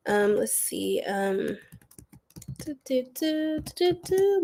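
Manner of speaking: usual speaking voice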